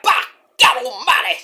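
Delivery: a lighter second call of the catchphrase; Jim Ross impression. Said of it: "Jim Ross!".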